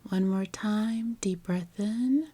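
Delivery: calm meditation guide, long unhurried pauses; guided meditation. Acted.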